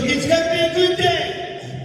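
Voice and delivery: high bright mock sing-song; cheery host mother. Comic imitates the host lady's high cheerful promise. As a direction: sell the day like a cheery host mom. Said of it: "She goes".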